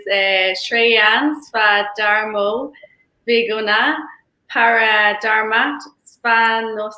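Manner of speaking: slow, careful Sanskrit verse chanting; Sanskrit recitation. Shown as a demonstration. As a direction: recite the Sanskrit shloka slowly and deliberately. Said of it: "In my Google accent".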